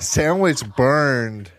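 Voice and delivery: put-on slow, high baby talk. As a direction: whine it like a toddler. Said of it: "[name]'s baby voice gets me every time".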